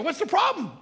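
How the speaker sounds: high, quick, puzzled retort; acted innocent bafflement; puzzled question. Unaware wife asks her worried husband, baffled.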